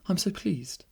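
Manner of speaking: high, happy princess voice; delighted young princess. A narrator's reading. Princess to the lucky winner, delighted. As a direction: say it high and pleased.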